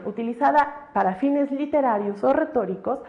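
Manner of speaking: ordinary talk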